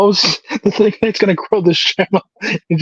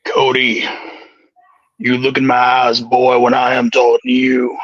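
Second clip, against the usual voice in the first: Undertaker impression; 5 semitones lower than usual.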